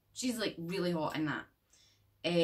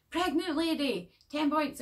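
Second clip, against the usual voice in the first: gleeful game shout; 9 semitones higher, 4 dB louder than usual. Retold or imitated. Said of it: "in the cart going".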